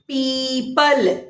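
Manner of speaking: Gita drawn out very slowly, syllable by syllable; slow classroom enunciation; slow teaching demo. Shown as a demonstration. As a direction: spell out Gita slowly for children. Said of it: "बड़ी की मात्रा".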